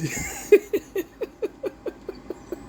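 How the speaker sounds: airy wheezing laugh; breathy laugh. Real, not acted. Laughing mid-rant.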